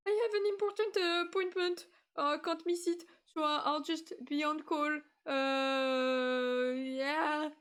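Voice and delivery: high, loud stressed excuse. Retold or imitated. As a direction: blurt it out, mock-panicked and strained. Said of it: "being so stressed".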